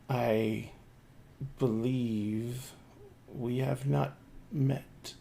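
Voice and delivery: slow, soft and measured; a lightly voiced nobleman, quiet and deliberate; hushed formal introduction; courtly lord.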